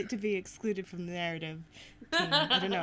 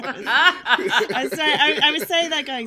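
louder, laughing through the words; 5 semitones higher, 12 dB louder than usual. Real, not acted. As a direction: giggle while explaining. Laughing apology.

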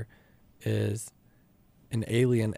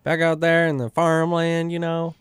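higher, louder country folk voice; back-home farm folk; 6 semitones higher, 6 dB louder than usual. Pretend. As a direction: ham it up like folks from the farmland.

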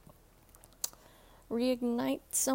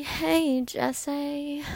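calling out; 3 semitones higher, 8 dB louder than usual. Real, not acted. Calls out to Jesse.

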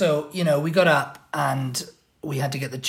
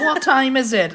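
mock question; 10 semitones higher, 6 dB louder than usual. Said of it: "She never knows what time it is".

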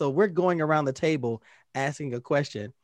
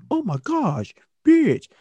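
excited; 8 semitones higher, 5 dB louder, slower than usual. Real, not acted. Excited exclamation.

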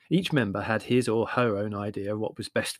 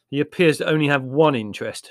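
loud, bitter grumble; 3 semitones higher, 8 dB louder than usual. Acted. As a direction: complain with mild bitterness. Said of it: "said Larry bitterly".

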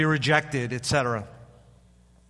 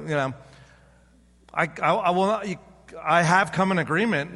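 ordinary talk, nothing marked

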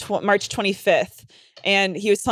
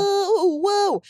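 much higher delighted whoa; high playful whoa whoa; excited whoa; 10 semitones higher than usual. Real, not acted. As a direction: gasp it out with delight. Excited exclamation.

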